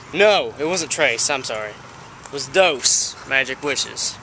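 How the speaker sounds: ordinary delivery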